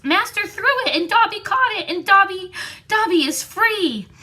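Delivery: high, squeaky house-elf, stunned; can't believe what he holds; elf in disbelief. A narrator's reading. Dobby, disbelieving, to Malfoy: high squeaky elf voice.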